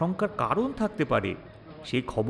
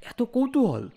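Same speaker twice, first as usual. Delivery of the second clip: pitched-up, amused disbelief; mock surprise; 9 semitones higher than usual. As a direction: act amazed at the public's curiosity. Narrator reacts to editor's request. (অবাক হওয়ার ভান)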